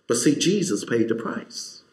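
ordinary talk, nothing marked

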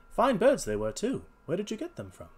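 high-pitched, chatty detective; genial detective. Acted. Holmes questions the salesman.

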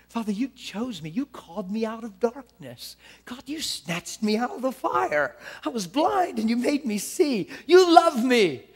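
full-throated praise, rising to a shout; preaching at full force. Shown as a demonstration. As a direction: build to a roaring declaration. Prayer builds to full force.

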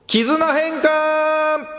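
long, high, slow title announcement; drawn-out title call. Acted. Host calling out the corner name, drawn out high and slow. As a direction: stretch the corner name out like a jingle.

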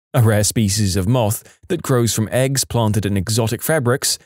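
ordinary delivery

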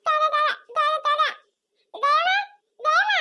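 high-pitched, insistent cries; childish calling out. Acted. Little one calling for the woman, high and insistent. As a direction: call out shrilly like a demanding child. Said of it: "ಅಯ್ಯೋ, ಹಂಗೆಲ್ಲ ಕೂಗ್ಕೂಡ್ದು".

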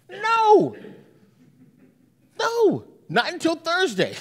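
God refusing the prayer with finality. Acted.

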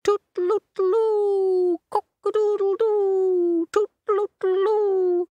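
very high, slow tooting crow; piercing, held toots and crowing; cockerel trumpet. Acted. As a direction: trumpet it out like a rooster. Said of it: "play the trumpet as loud".

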